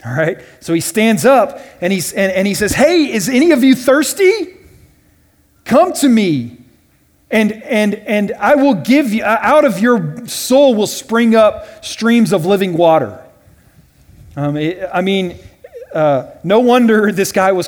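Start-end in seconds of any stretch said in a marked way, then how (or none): 2.7-13.2 raised, higher call to thousands of people; calling out to a crowd. Retold or imitated. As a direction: call it out over a huge crowd. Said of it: "He's probably screaming at the top of his lungs".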